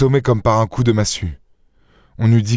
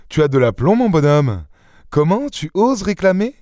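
higher-pitched, scoffing disbelief; mock indignation; 8 semitones higher than usual. Daspry scoffing at his comrade's demand for money, mock indignant. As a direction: marvel at the other's nerve.

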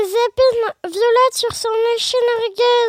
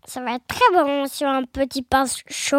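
low monster voice; Gruffalo qui grogne; 6 semitones lower than usual. A narrator's reading. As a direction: drop deep, a monster savouring its meal. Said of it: "grogna le Gruffalo". (voix grave de monstre gourmand)

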